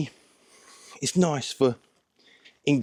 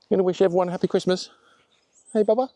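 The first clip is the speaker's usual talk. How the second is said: fast, high pet talk; high voice for the dog; 4 semitones higher, much faster than usual. Real, not acted. Talking to the dog.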